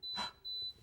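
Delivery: quiet gasp of wonder; soft amazed gasp. Shown as a demonstration. Demonstrating to a child, soft surprised gasp. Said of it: "it sounds just like this".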